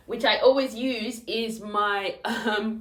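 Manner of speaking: ordinary delivery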